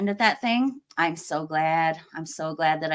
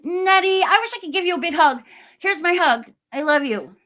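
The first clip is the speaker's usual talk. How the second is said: gushing affection; 10 semitones higher, 4 dB louder than usual. Real, not acted. Sending a hug to a viewer.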